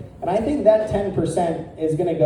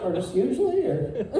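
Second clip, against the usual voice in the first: high, mocking, awkward teen voice; 10 semitones higher than usual. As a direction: parody his clumsy question with a raised pitch. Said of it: "What a terrible way to ask that".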